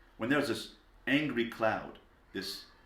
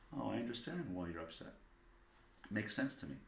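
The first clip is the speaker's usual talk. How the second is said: soft, soothing validation; hushed, empathetic parent voice; understanding; 9 dB quieter than usual. Acted. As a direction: quietly reassure an upset child. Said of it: "Be understanding".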